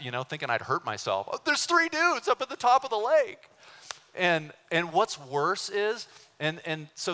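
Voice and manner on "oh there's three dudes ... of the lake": high, strained yell of dismay; acted cry of outraged dismay; dismayed calling out. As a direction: whine the bad news up high. Acts out his dismayed shout.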